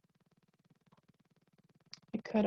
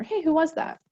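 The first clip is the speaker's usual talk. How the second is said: casual curious question; 7 semitones higher than usual. Pretend. Asking partner in healthy relationship.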